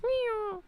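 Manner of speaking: high-pitched sci-fi weapon noise; high sliding "pew" noise; imitated laser zap. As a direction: mimic a space cannon firing. Imitates Star Wars laser cannon.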